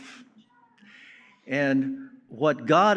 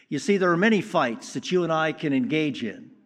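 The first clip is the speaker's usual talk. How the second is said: ordinary talk, nothing marked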